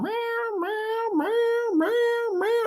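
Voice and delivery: high, drawn-out mock meows; begging cat meowing for treats. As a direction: imitate the begging cat, squeaky and insistent. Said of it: "she'll just keep going".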